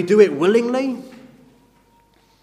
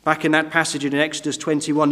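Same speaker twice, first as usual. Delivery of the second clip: usual speaking voice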